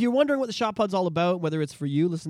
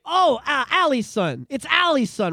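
recognising greeting; 7 semitones higher, 5 dB louder, slower than usual. Retold or imitated. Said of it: "it's like".

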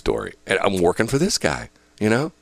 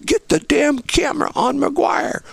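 high-pitched, exasperated director's tirade; retold angry order, strained and high; imitated cussing out. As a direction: chew out the cameraman, voice pitched high. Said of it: "he cusses at me".

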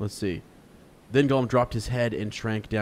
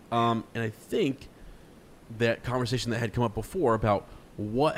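ordinary talk, nothing marked

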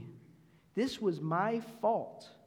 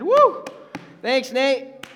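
much higher, louder gleeful whoop; a little brother thanking his brother for taking blame, joyful; relieved cheer; 9 semitones higher, 14 dB louder, much slower than usual. Retold or imitated. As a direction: cheer like a kid let off the hook.

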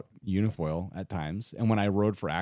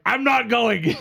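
high, loud comic yell; hammed-up shout of being left out; retold outburst; 7 dB louder, slower than usual. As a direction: cry out in mock protest.

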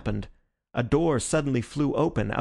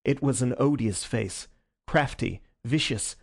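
ordinary delivery

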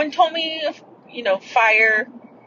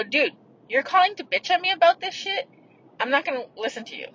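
higher-pitched, incredulous challenge, then a quick dismissal; frustrated retort; 6 semitones higher than usual. Retold or imitated. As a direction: retell it as an exasperated comeback. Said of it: "And I was getting frustrated".

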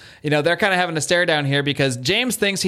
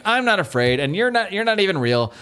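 mock scream, higher pitch at normal volume; half-hearted retold scream, raised in pitch; 6 semitones higher than usual. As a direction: imitate a frightened protest, pitched up without shouting.

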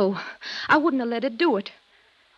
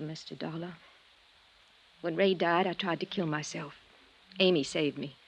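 much softer, subdued and heavy; hushed grief; 4 semitones lower, 5 dB quieter than usual. Acted. Sister recalls her suicide attempt to the detective, quiet grief. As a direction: let the memory drag the voice down to almost nothing.

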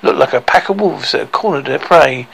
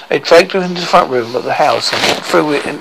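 plain reading voice

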